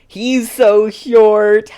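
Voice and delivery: drawn-out high amused cry; amused exclaim. Real, not acted. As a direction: marvel at how tiny he is. Laughing at tiny character.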